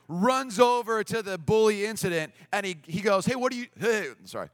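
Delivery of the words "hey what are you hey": higher, louder challenge; retold indignant call. Skate guy breaks up bullying, calling out sharply. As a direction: call out to stop someone, mildly acted.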